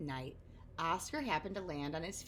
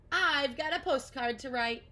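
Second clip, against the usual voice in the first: louder, higher; cheerful resolve; 5 semitones higher, 6 dB louder than usual. Acted. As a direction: Oscar setting off to Mexico, eager. Oscar leaving happily.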